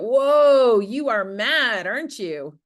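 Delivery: loud, drawn-out accusing whoa at a partner; mock-confront a spouse: whoa, you are mad. Shown as a demonstration. A counselor playing a spouse who calls out the anger. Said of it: "What about".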